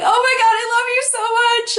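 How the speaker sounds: high, rushed gushing squeal; re-enacted thrilled outburst; freaking out. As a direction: gush it fast and high, overjoyed. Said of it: "freaking out".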